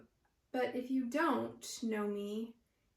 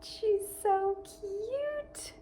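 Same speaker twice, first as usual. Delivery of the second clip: high cooing voice; 11 semitones higher, 4 dB louder, much slower than usual. Real, not acted. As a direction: coo over something cute. Cooing over a crocheted calf.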